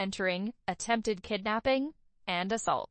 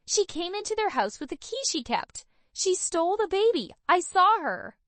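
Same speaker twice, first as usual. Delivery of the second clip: cutting through; 8 semitones higher than usual. A narrator's reading. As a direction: cut through the screaming. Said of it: "her voice cutting through Carly's screams".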